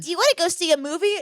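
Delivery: high, fast mock date asker; hammed-up dinner date voice. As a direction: mimic a boring date's question. Mocking a dinner date question.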